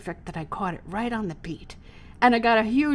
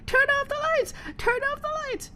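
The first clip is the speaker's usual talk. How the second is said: high mock yell. Performer calling to the light operator, high mock yell. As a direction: call it up to the booth. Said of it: "I yelled out".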